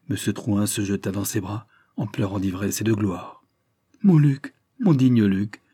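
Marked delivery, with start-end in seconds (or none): 4.0-5.6 loud, high cry of joy; cried out. A narrator's reading. Old father crying out to his son, loud and joyful. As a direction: call out the son's name in rapture. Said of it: "s'écria-t-il".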